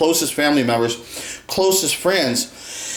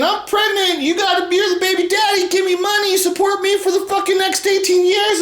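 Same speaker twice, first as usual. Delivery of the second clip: much higher, whiny demanding woman; mock woman's demands; 13 semitones higher than usual. Imitating a pregnant woman demanding child support, high and demanding. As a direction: mimic her demanding money, high and pushy. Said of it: "you're saying".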